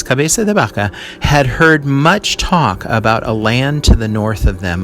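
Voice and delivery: normal talking voice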